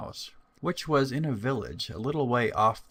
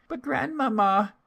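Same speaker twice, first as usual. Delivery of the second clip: put-on much higher, louder little girl voice; 4 dB louder than usual. Girl at grandmother's door, high child voice. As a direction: answer brightly like a small child. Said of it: "said the little girl".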